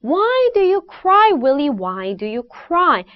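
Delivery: sing-song chant, high opening WHY stepping down to a falling cry; rhythmic tongue twister. Shown as a demonstration. Coach leads students in tongue twister, lively rhythmic chant. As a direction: bounce "Why do you cry" along, starting high and dropping. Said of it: "느낌과 리듬을 살려볼게요".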